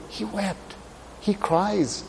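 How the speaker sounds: very soft, slightly lifted voice; quiet, moved preaching; hushed compassion. Real, not acted. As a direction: drop almost to a hush, tenderly. Dwells tenderly on Jesus weeping.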